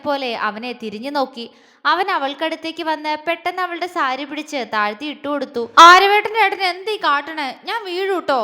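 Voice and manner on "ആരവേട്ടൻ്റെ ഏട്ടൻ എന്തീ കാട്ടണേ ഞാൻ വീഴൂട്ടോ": high, loud whiny complaint; upset plea, pitched up; sad protest. A narrator's reading. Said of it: "അവൾ സങ്കടത്തോടെ പറഞ്ഞു". (സങ്കടത്തോടെയുള്ള പരാതി)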